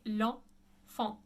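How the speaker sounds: L'Enfant as "lah(n)-fah(n)", silent t; two nasal vowels in L'Enfant; French pronunciation. Shown as a demonstration. Teacher gives students the correction, French pronunciation. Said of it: "the correct pronunciation would be".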